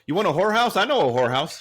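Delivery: loud, high-pitched pushy cabbie; cab driver's offer. Retold or imitated. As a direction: impersonate a cabbie eagerly pitching loudly. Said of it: "the cab driver goes".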